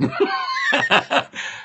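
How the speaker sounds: airy laugh jumping way up; breathless high laugh. Real, not acted. Speaker laughing after quoting the doctor, breathless high laugh. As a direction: let a wheezy laugh escape. Laughs at own grim quote.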